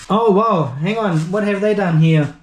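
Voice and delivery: normal talking voice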